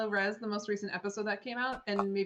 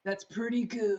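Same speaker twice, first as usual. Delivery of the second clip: American accent; slower than usual. Shown as a demonstration. Joins the American accent round.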